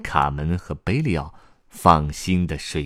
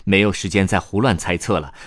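ordinary delivery

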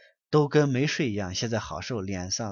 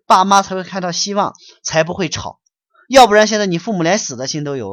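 raised, loud lecturing rebuke; higher and louder, hammering the blame home; stern scolding; 6 semitones higher, 7 dB louder than usual. Real, not acted. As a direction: scold him hard like a strict master. Scolding a questioner.

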